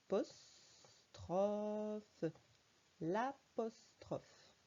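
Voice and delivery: apostrophe drawn out very slowly; each syllable given its own beat; slow syllable reading. Shown as a demonstration. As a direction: sound it out for a young reader. Teacher reads word for child.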